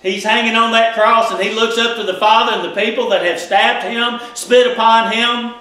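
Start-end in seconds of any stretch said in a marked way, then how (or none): none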